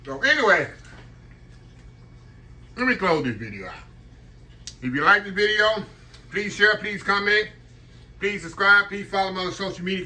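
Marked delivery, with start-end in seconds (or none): 4.8-10.0 louder, higher upbeat call; excited. Real, not acted. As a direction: hype up the viewers. Enthusiastic channel sign-off.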